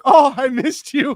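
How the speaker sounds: hammed-up affection for a plastic straw; mock tender. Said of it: "And I was like".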